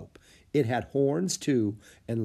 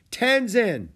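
high, loud call of a name; shout; 12 semitones higher, 7 dB louder than usual. A narrator's reading. Boy hails the guide near the ridge, shouting. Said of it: "Jack shouted".

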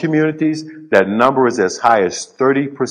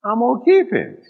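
high determined answer; 11 semitones higher than usual. Retold or imitated. Father's answer, voice rising.

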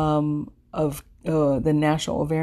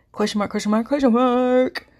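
hammed-up nagging; 8 semitones higher, 4 dB louder than usual. Body nagging her, continued.

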